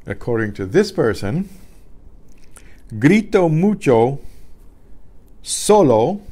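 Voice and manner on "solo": solo with the first O loud and raised; stress on first O. Shown as a demonstration. Teacher giving the class the answer, stress on the first O of solo. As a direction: lean hard on the first O of solo. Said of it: "with an accent on the first O".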